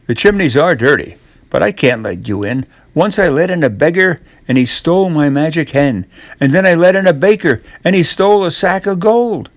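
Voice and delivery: fretful woman. Acted. Woman tells Jack why she can't let him in, anxious and hurried. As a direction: rattle off past thefts anxiously.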